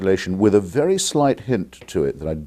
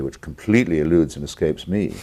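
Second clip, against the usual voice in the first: usual speaking voice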